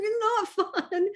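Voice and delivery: high, slow, protest broken by a laugh; giggly mock whine. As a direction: laugh through the complaint. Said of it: "I'm like".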